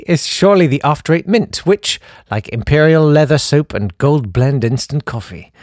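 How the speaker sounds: usual speaking voice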